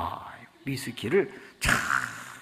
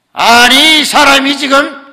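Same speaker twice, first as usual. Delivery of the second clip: sudden shout, much higher and louder; angry outburst; 11 semitones higher, 20 dB louder than usual. Retold or imitated. Chairman yells at the employee who put down his glass. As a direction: bark the rebuke at him. Chairman angrily rebukes drinker.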